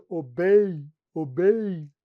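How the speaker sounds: ô bem pushed through the nose, heavily nasal; mock nasal, low ô bem. Shown as a demonstration. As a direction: talk like someone badly blocked up. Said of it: "Quando você tá extremamente fanho".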